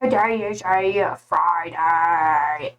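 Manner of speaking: lightly voiced slow old man. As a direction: drag it out like an old grandpa. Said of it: "You like my old man voice?".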